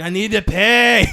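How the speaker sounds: slow, high Scottish yell; Scottish accent. Acted. Scottish customer insists to confused worker, slow Scottish yell. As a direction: draw it out loudly as a Scottish lady. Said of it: "this Scottish lady walks up to me".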